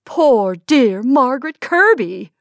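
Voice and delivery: slow, loud, stunned "Poor dear Margaret Kirby!"; shocked exclamation. Acted. Woman stunned at seeing her old friend, slow loud exclamation.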